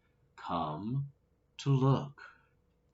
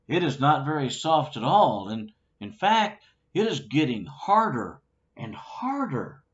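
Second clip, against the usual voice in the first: growing alarm; 4 semitones higher, 6 dB louder, faster than usual. Acted. Pig stuck in hardening mud.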